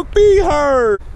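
mock a tired kid complaining; mimicking whiny kid. Dad mimics daughter's complaint after playing outside, whiny and mocking. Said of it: "She will come back and talk about".

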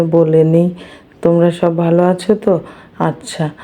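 normal talking voice

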